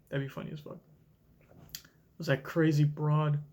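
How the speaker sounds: usual speaking voice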